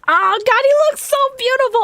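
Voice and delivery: high, loud gushing; excited swooning. Real, not acted. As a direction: gush over him, thrilled. Swooning over character art.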